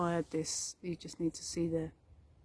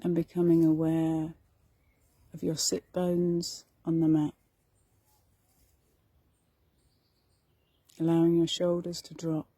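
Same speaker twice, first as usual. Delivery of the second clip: guided meditation; 7 dB louder than usual. Acted. Meditation teacher guiding listeners through meadow and forest, guided meditation. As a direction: lead them unhurriedly through the imagery.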